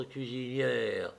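slow, hollow, hushed from the grave; sepulchral voice. A narrator's reading. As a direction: drag it out like a voice from the tomb. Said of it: "ajouta Thomas d'une voix sépulcrale".

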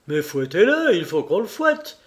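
doctor demanding a whipping, furious; furious outcry. A narrator's reading. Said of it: "s'écriait ... hors de lui".

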